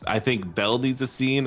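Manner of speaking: ordinary talk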